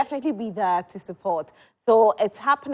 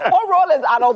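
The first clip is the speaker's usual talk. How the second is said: laughing, louder, then asking through it; delighted laugh; 5 semitones higher, 8 dB louder than usual. Real, not acted. Host hears a cast name she loves, laughing and louder.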